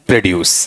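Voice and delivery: "प्रोड्यूस" as pro-DYOOS, with d-y. Shown as a demonstration. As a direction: keep a clear d plus y in "प्रोड्यूस". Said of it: "यहां वाले part में हम".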